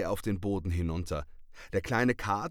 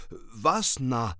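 hissing; 3 semitones higher, 5 dB louder than usual. Acted. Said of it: "fauchte Maurizio".

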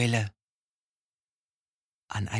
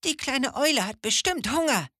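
much higher squeaky squirrel voice; squirrel calling; 12 semitones higher than usual. A narrator's reading. Squirrel guessing the owl is hungry, high chirpy call. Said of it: "ein Eichhörnchen ... rief".

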